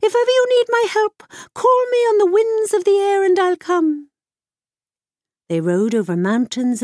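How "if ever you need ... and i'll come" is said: bird king voice. Acted.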